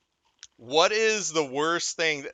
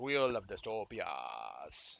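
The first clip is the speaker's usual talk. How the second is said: much lower, softer, slow title; deep hushed announcer; 7 semitones lower, 12 dB quieter, much slower than usual. Acted. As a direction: drop it low and ominous. Slow show-title announcement repeated.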